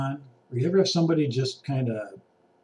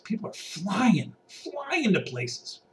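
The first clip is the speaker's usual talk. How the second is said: rising excited drawl; 6 semitones higher, 6 dB quieter, much slower than usual. Acted. Mimicking frantic shoppers flying.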